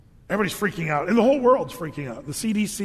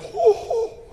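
sudden high laugh; high burst of laughter; 4 dB louder than usual. Real, not acted. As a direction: laugh out loud at the joke. Laughs at fridge story.

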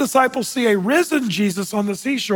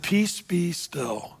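very slow, soft and steady; hushed calm command to the waves; 2 semitones lower, 6 dB quieter, much slower than usual. Retold or imitated. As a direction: still the storm gently, slowly. Jesus calms the storm.

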